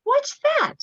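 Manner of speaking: high little raccoon voice; curious child. A narrator's reading. Little raccoon asking his mother, high eager voice. Chester asks about kissing hand.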